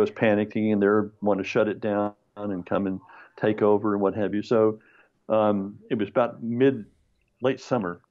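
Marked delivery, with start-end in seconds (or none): none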